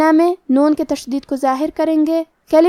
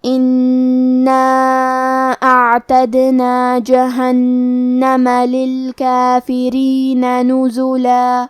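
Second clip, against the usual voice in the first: very slow chanted tajweed recitation; slow melodic Quranic recitation; 2 semitones lower, much slower than usual. Acted. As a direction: recite the verses slowly with long drawn vowels. Teacher recites verses for tajweed lesson.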